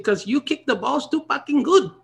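put-on high Filipino accent, laughing through it. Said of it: "his thick Filipino accent".

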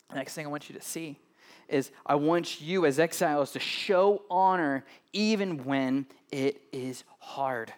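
ordinary delivery

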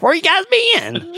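high, slow imitation of Raiden; drawn-out high voice; high slow mock Raiden. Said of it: "Raiden's like".

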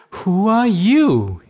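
high, bright little bunny greeting. Acted. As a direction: call out like a small curious bunny. Bunny asks crickets.

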